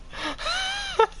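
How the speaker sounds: high shriek into a laugh; real scared shriek laughing; startled shriek laugh. Real, not acted. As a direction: shriek in fright then laugh. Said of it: "You scared me".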